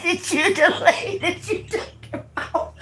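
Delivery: words dissolving into breathless laughter; laughter swallowing the last words; can't help laughing. Real, not acted. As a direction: tell it while cracking up. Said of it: "I can't help it".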